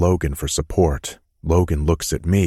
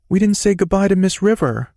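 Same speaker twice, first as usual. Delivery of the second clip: put-on high, young boy's voice; child's voice; 4 dB louder, faster than usual. A narrator's reading. Little boy protesting to his dad about leaving.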